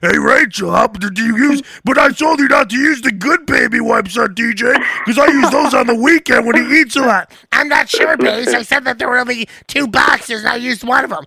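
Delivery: wife and husband squabbling over baby, mock-shrill; mock bickering couple. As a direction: bicker like a frazzled parent. Acted couple quarrel.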